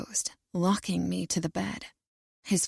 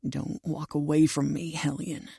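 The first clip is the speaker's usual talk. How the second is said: low male growl, trailing quieter; 4 semitones lower, 3 dB quieter than usual. A narrator's reading. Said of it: "he growls".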